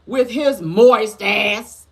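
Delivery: loud, high, drawn-out disgusted emphasis; emphatic punchline. Real, not acted. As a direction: blurt it out, stretching every word. Drawn-out punchline after mock hesitation.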